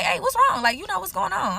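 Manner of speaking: sweet, high checking-in voice; innocent concern. Retold or imitated. Boyfriend checking on his mad girlfriend, sweetly. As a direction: play it innocent and friendly. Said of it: "you know, just being myself".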